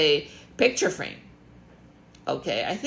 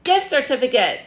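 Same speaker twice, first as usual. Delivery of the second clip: ordinary talk, nothing marked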